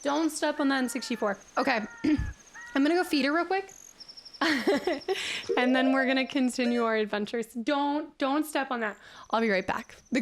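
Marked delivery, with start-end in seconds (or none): none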